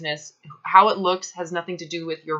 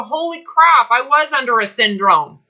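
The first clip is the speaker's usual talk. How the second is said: higher and louder, a mock shocked gasp of insight; shocked realization; 5 semitones higher, 6 dB louder than usual. Coach voicing the client's realization, mock shocked and loud. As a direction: act out the sudden wake-up call. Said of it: "You're now starting to go".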